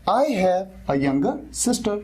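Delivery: each word drawn out for learners; very slow model sentence. Shown as a demonstration. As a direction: dictate it slowly. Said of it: "modelling sentence slowly". (बहुत धीरे, सिखाते हुए)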